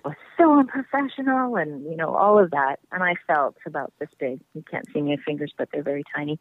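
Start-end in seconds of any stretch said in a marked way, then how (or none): 0.0-1.6 high scolding voice; angry father figure. Retold or imitated. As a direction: scold like a stern stage dad. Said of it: "He's like".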